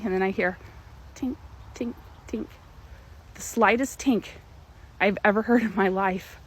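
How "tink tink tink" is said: soft tinking noise. Retold or imitated. Imitating engine noise.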